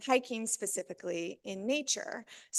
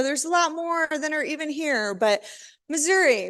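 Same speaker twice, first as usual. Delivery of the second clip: normal talking voice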